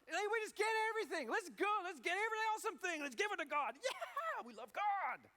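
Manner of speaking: high, loud, racing burst of excitement; eager Abel. Acted. Abel rushing to give God his best, wildly excited. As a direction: whoop it up with overflowing enthusiasm. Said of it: "he's just got the very best that he can find".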